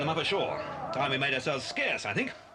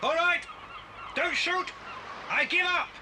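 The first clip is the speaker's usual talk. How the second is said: shouted surrender; 12 semitones higher than usual. Acted. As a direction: shout it so the gunmen hear. Bill surrenders to armed men.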